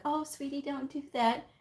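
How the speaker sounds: put-on baby the kid too sweetly; imitating a soft parent; mock sweet parent. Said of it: "I would never be like".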